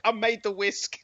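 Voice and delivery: smug blacksmith comeback. Pretend. The blacksmith's cocky comeback to the baker, loud and high. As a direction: fire back the punchline with swagger. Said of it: "You'd be like".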